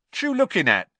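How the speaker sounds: put-on high, loud cheeky young voice; cockney lad. A narrator's reading. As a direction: blurt it like a street lad. Stan voiced in story.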